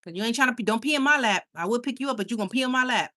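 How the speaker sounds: ordinary talk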